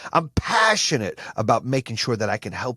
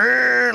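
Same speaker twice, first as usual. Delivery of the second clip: a high, loud straining growl; mock effort growl; 13 semitones higher, 8 dB louder than usual. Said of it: "gets out there and goes".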